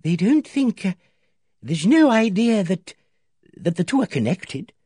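put-on high, hesitant old lady voice, slowing; nervous. A narrator's reading. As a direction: falter over the dreadful idea. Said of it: "Emily Barton said nervously".

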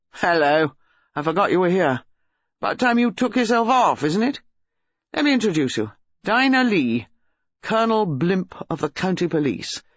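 offhand, mocking introductions and dismissal; insolent young man. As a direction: send the colonel packing with breezy rudeness.

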